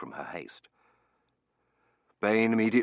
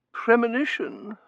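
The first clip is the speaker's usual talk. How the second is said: high, slow, questioning voice; vague; 7 dB louder, slower than usual. A narrator's reading. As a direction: echo it slowly, still dazed. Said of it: "Missus Mearing said vaguely".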